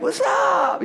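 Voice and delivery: high, whooping greeting. Retold or imitated. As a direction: shout it high like an excitable skater friend. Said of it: "that's, that's him".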